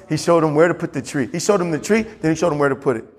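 normal talking voice

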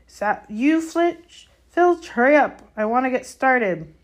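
high, loud call across the grounds; distant shout. A narrator's reading. Hagrid hailing Filch from his hut, shouting. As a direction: shout to hurry someone along. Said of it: "they heard a distant shout".

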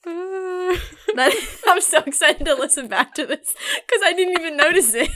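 high, loud, laughing through the words. Real, not acted. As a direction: talk through a real fit of giggles. Cracks up over her own accent.

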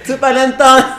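high, loud, laughing mock yell; playful imitation of a shouted taunt; shouted heckle. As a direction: heckle back loudly through a laugh. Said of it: "Que le gritaban".

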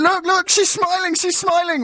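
high, slow, gushing proud-parent squeal; smug proud dad. Retold or imitated. Proud new dad gushing to the nurse, high and slow. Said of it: "I was being a little bit smug and show-offy".

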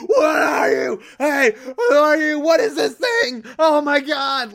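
high, loud panicked cries at a monster; panicked yelling. Retold or imitated. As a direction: scream it like you've seen a death god.

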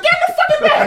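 high, loud, laughing bark to get in the back; mock yelled order. Friend acting out yelling at the girlfriend to get in the back, laughing shout. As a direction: yell it at the ex like a fed-up boyfriend.

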